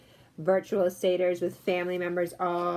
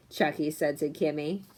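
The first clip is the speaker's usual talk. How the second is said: plain reading voice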